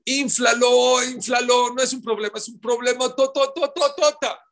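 high, loud overblown shouting; exaggerated shout. Shown as a demonstration. Preacher mocking people who inflate problems, shouting.

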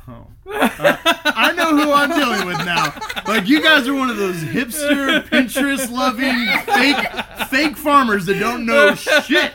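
loud, high, mocking scoff at fake farmers; sarcastic officer. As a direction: sneer at them, laughing loudly. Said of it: "he looked at us and he's like".

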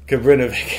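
Kabrinovic with a hard K ending; American pronunciation. Shown as a demonstration. Defending the American version of the name, American pronunciation. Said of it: "the American version is".

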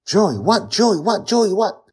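high, loud, repeated frantic cry; frantic calling. A narrator's reading. Father frantic after a stabbing.

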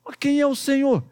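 high-pitched, puzzled question; Saulo asking. Acted. (pergunta em tom agudo, intrigado)

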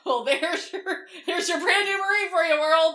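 high, loud, laughing proclamation; amused mock presentation. Mother presenting her lost Marine son to the world, laughing mockery.

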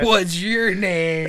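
slow, drawn-out come-on; hammed-up flirting, stretch the words out; cheesy pickup line. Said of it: "one guy's all like".

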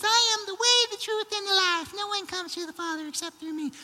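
very high, squeaky miniature voice; tiny Jesus. Acted. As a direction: squeak it out as a pocket-sized savior. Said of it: "really small Jesus".

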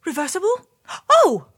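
high, excited cry of delight; cartoon princess. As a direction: squeal it out, suddenly hopeful. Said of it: "cried the princess".

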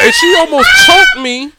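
high, loud indignant squeal; shrieking at being nearly choked; squealed outrage. Real, not acted. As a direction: cry out in disbelief. Laughing outrage retelling.

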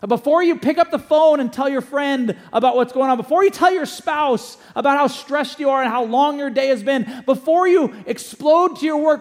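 rising sermon intensity, loud and high; full-force preaching. Acted. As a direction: preach it out to the room.

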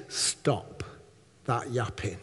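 calm the accuser down in a hush; hushed rebuke. Acted. God to the accusing voice, a hushed rebuke. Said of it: "God is saying to that voice".

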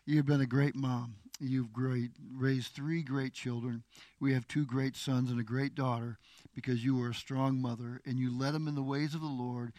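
soft, choked-up words to his wife; tearful tenderness. Real, not acted. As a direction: speak gently, holding back tears. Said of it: "Making me cry".

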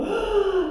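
sharp surprised gasp; high gasp of surprise. Acted. Storyteller gasps at the prince. (suspiro de surpresa)

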